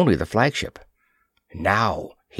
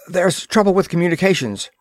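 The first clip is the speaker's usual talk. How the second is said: gasped, hurried; 8 semitones higher, faster than usual. A narrator's reading. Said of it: "he gasped".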